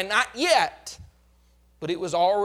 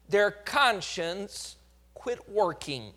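ordinary talk, nothing marked

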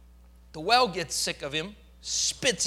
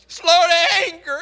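frustrated cry; 9 semitones higher, 10 dB louder than usual. Acted. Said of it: "Listen to the frustration of his prayer".

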